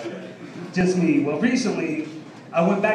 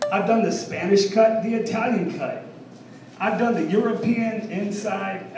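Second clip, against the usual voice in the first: ordinary delivery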